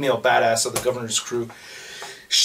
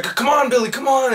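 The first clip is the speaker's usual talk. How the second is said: higher, pleading call to a friend; urgent plea; 9 semitones higher than usual. Retold or imitated. Herschel begging Billy to keep running, higher and urgent. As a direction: urge him on, rising. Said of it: "he's like".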